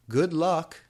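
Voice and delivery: high, loud cheerful send-off; cheering. A narrator's reading. Friends sending her off, high loud cheer. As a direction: shout good luck to her. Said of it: "everyone shooted".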